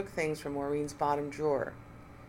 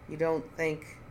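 slow; slower than usual. Acted. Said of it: "Julian said slowly".

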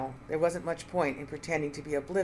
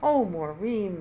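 a louder, higher mock-sorrowful moan; 4 semitones higher, 6 dB louder, slower than usual. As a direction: grieve theatrically over her friend. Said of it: "Nina moaned".

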